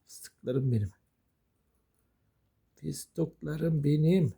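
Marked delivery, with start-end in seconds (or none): 2.8-4.3 very slow, stretched cooing; drawn-out endearment. Real, not acted. Owner doting on the pets, drawn-out coo. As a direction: stretch every word fondly.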